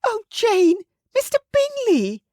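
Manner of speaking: very high, loud overjoyed cry; Missus Bennet gushing. Acted. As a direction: call out in a very high ecstatic squeal. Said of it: "Missus Bennet screamed".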